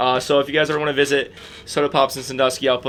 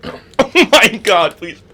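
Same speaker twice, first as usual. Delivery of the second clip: burning mouth pain; 6 semitones higher, 7 dB louder, much slower than usual. Real, not acted. Just ate Carolina Reaper.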